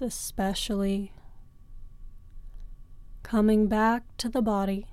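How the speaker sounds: ordinary talk, nothing marked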